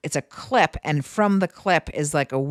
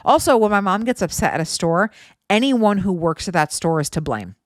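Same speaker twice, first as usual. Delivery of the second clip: ordinary talk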